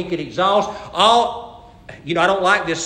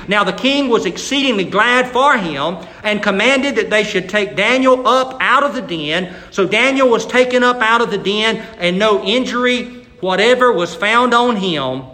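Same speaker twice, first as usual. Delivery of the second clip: louder, raised scripture reading; 4 semitones higher, 6 dB louder than usual. Acted. Pastor reading Daniel to the church, raised reading. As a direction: read the verses out with weight.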